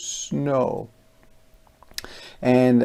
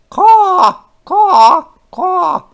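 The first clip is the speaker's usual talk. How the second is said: very high, loud cawing; shrill-free loud bird caws; crow caws; 11 dB louder, slower than usual. Acted. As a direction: caw like a crow up in a tree.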